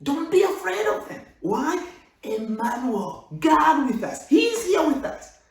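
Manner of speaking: high, loud triumphant preaching; loud high triumphant sermon close; rousing preaching. Acted. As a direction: lift them up with confidence.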